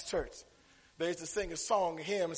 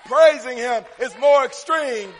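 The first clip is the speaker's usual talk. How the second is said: loud, rising preacher's delivery; full-force preaching; 6 semitones higher, 10 dB louder, slower than usual. Real, not acted. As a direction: preach it hard to the congregation.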